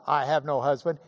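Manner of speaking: echoed back with a rising, teasing lift; knowing echo. Retold or imitated. Jesus repeats the woman's words, teasing. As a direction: hand her own words back, amused.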